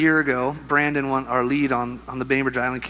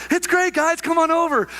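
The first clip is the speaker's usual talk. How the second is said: very high chirpy call; mock-cheery falsetto calling the group over; squeaky, carefree encouragement, imitated; eager young teammate.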